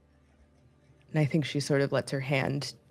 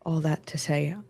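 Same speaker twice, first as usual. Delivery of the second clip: soft, hushed confession with long pauses; intimate whisper; 4 dB quieter than usual. Acted. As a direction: murmur it close into their ear. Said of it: "as you whisper that into".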